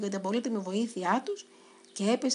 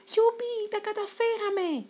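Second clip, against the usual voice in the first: much higher, squeaky victory cry; joyful cheer; 11 semitones higher, slower than usual. Acted. Mouse cheering when the lighthouse lamp lights up. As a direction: cheer with tears of joy. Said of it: "φώναξε ο Κίμωνας".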